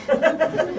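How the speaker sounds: a quick high burst of laughter. Real, not acted. Recalling all the women in the store, laughing. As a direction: laugh at himself.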